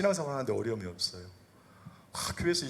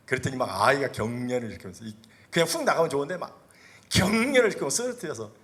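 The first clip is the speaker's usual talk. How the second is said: usual speaking voice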